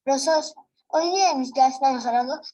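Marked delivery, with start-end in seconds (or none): none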